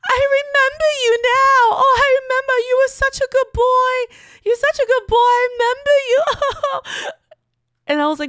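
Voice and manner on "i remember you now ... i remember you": high, loud, hammed-up fake sob; mock sobbing, much higher; fake crying. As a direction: wail like a drunk mom pretending to cry. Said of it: "fake crying".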